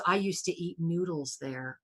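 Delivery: low, soft aside; imitated movie line, softly reflective; quoting Neo. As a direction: drop the voice for a quiet quote. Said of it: "and goes".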